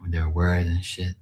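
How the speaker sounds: ordinary talk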